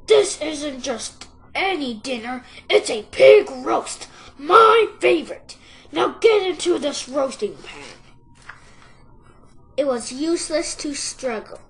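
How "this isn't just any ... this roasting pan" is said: loud, emphatic villain fox; big, bossy fox voice; gloating fox. A narrator's reading. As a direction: relish it loudly, then order firmly. Fox gloats over his dinner.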